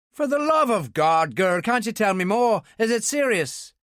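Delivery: put-on begging the girl for news; pleading Ulster man. A narrator's reading.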